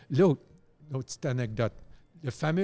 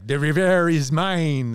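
slow, louder mock lumberjack's accent in English; 3 semitones higher, 10 dB louder, slower than usual. Describing the cartoon villain to friends, slow mock lumberjack's accent. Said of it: "son accent".

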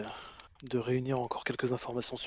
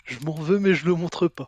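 higher and louder, insistent; emphatic confession; 6 semitones higher, 13 dB louder than usual. Acted. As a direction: push the guilt out loud. Character admits guilt, loudly.

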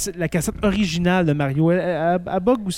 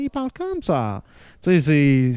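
put-on Italian singsong; exaggerated Italian accent. Shown as a demonstration. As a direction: swing the pitch way up like a cartoon Italian. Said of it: "pas trop exagéré l'accent italien".

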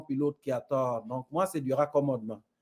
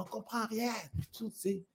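a lightly voiced grumbling viewer, higher and softer; mock viewer complaint; 7 semitones higher, 10 dB quieter than usual. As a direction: whine it like a confused viewer. Viewers' complaint letters quoted. (plainte de téléspectateur, voix plus aiguë et douce)

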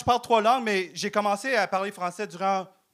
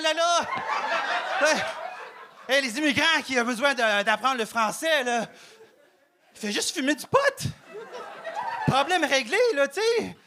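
a higher, hammed-up Québécois accent; mock Québécois accent; 4 semitones higher than usual. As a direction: turn into a pure-laine guy from Lac-Saint-Jean, a touch higher. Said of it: "j'ai perdu mon accent".

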